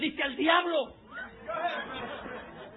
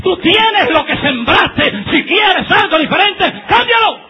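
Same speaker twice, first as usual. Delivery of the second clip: preacher rising from scolding to shouting; preaching building to full force; 3 semitones higher, 11 dB louder than usual. Acted. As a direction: build the sermon up till you're shouting. Prediger steigert sich.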